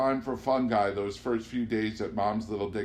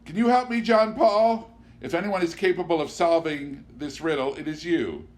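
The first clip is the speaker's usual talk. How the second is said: much higher, louder woman's voice, asking for help; Amanda, pleading; 7 semitones higher, 6 dB louder than usual. Acted. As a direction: coax him to solve her riddle.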